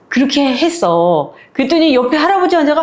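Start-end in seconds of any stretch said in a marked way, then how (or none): none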